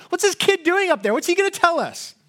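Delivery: high, incredulous mock scorn; mock heckling. Acted heckler scorning young preacher.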